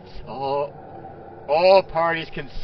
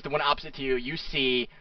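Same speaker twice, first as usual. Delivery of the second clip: usual speaking voice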